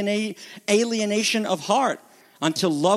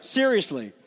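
usual speaking voice

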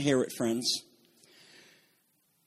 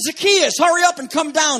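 high and loud, calling him down; 7 semitones higher, 7 dB louder than usual. A narrator's reading. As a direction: call him down from the tree. Jesus calls Zacchaeus down.

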